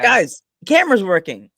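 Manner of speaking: loud, high call to the group; mock announcement. Host acting out Val in the video, loud announcing. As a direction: call it out to everyone off camera.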